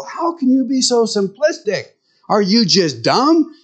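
high, exasperated put-on woman's voice; mock woman. Wives mocking simple husbands, high exasperated voice. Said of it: "women look at this and go".

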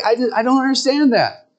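high, baffled put-on woman's voice; mock woman. Woman staring at the men's switch, high baffled voice. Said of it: "women will look at, you know, and say".

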